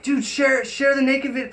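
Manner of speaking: ordinary talk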